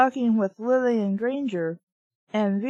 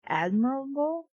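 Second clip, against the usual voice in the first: admirable? with a doubting rise; incredulous echo; puzzled reread; 3 semitones higher, 5 dB quieter than usual. Real, not acted. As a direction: question the word admirable. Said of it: "that's what it says".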